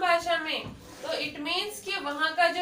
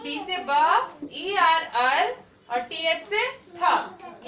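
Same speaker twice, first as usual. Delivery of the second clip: slow phonics spelling; much slower than usual. Shown as a demonstration. Teacher spelling the word slowly for students.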